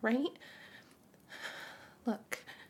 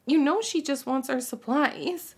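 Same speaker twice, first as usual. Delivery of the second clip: sharp warning; 4 semitones higher, 8 dB louder than usual. Acted. Jealous warning about Kimmy.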